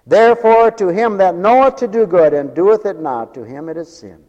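loud, high pulpit recitation; ringing Bible quotation; scripture proclamation. Real, not acted. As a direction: proclaim the verse with full preaching force.

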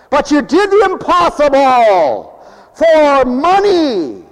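preacher pounding the pulpit; preaching. Acted. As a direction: drive the point home slowly. Preacher hammers point.

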